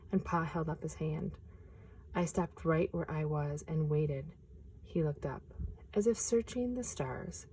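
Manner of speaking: gentle picture-book reading; storyteller reading aloud; story narration. A narrator's reading.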